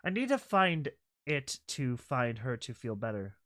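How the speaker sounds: normal talking voice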